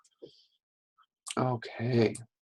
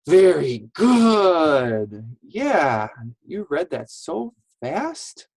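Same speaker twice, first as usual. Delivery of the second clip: drawn-out, louder, higher cheer; delighted praise; 4 semitones higher, 4 dB louder, slower than usual. Real, not acted. Teacher praises children who just read, delighted praise. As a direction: praise big and slow, stretching GOOD.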